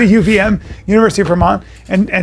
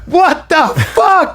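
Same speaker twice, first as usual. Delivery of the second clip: slow, higher drawn-out what the fuck; mock disbelief; 8 semitones higher, much slower than usual. Hungry bandmate handed the plate, slow mock shock. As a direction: stretch it out in hammed-up shock. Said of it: "And he goes".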